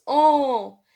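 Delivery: "en" as one long nasal vowel; drawn-out nasal vowel. Shown as a demonstration. Teacher showing pupils how A N sounds, long held en. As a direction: hold "en" out long. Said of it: "A N, elle se prononce".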